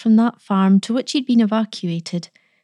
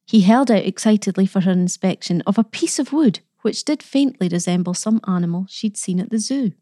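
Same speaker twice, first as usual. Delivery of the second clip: usual speaking voice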